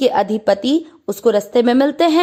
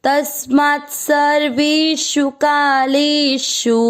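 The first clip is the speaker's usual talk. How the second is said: Shri in नैते स्मृति पार्थ जानन sung out slowly, a touch higher; slow verse recitation; 4 semitones higher, much slower than usual. Shown as a demonstration. Teacher reciting the Gita line slowly for students to follow. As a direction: chant each syllable of the verse slowly and evenly. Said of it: "repeat".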